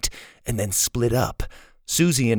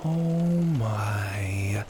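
hushed, whispered amazement; awed whisper; 2 semitones lower, 6 dB quieter than usual. A narrator's reading. Susie glimpsing the treasure, awed whisper. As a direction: whisper it in awe.

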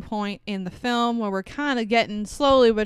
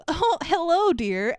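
higher-pitched, lightly put-on friendly hello; mock-sweet husband; 8 semitones higher, slower than usual. Husband greets his wife on the phone, mock-sweet. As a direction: imitate an overly nice husband, amused. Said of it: "And he goes".

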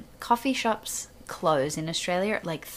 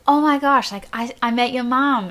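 higher and louder, gushing; excited gush; 6 semitones higher, 8 dB louder than usual. Retold or imitated. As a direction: burst out thrilled. Andy excited about meeting mom.

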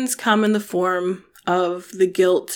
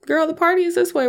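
high, fast sassy diva voice; sassy glamorous woman; 10 semitones higher, faster than usual. Retold or imitated. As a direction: play the welcoming glamorous hostess. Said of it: "She's like".

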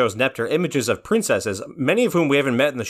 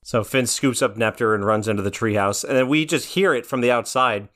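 ordinary talk

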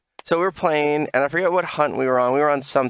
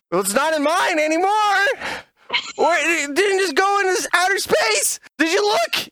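frustrated yelling. Retold or imitated. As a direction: yell it like the game is broken. Retold argument over missing potion.

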